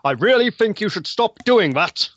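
high, loud indignant outburst; indignant demand. Acted. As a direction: shout it, fist shaking. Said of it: "and demand that".

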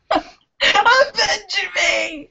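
dramatic dying cry, drawn out; overacted death scene. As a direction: wail it like a fallen hero.

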